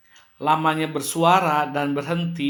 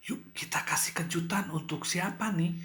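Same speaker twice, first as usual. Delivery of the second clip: secretive demo whisper; 3 semitones higher, 7 dB quieter than usual. Shown as a demonstration. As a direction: whisper as if plotting a surprise. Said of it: "ini sambil berbisik-bisik". (berbisik-bisik merencanakan kejutan)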